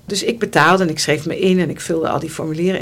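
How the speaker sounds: usual speaking voice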